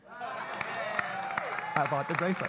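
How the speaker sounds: very slow, drawn-out repeat. Real, not acted. Pastor stretching the punchline about buying the bracelet, very slow.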